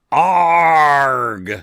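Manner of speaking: loud, drawn-out mock scream. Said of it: "It's written as".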